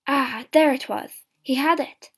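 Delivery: loud, excited narration; triumphant discovery. A narrator's reading. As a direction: exclaim with sudden relief. Finds the paw in the dark.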